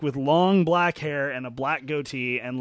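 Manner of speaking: usual speaking voice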